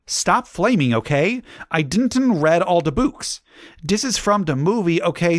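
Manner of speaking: high-pitched, defensive reading of misspellings; author's note voice. Acted.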